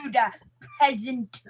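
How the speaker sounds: loud, haughty British put-on; mock British accent. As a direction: order a servant about in hammed-up British. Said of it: "is that your British accent".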